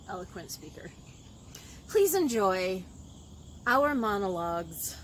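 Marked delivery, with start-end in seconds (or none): 1.9-5.0 loud, projected presentation; show host announcing. Acted. Host inviting viewers to enjoy the monologues, projected announcer style. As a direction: present the show grandly to the audience.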